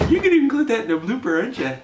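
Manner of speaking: quoting wife. Retold or imitated. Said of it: "She just said".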